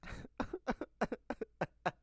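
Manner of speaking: imitated sobs tipping into laughter; mock cry-laugh in a long broken run; crying turning to laughing. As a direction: act out weeping that becomes a laugh. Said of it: "So this is my impression of her".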